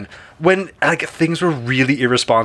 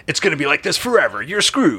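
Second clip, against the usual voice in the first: monster truck ad; 4 semitones higher than usual. Retold or imitated. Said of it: "it's the monster truck guy doing".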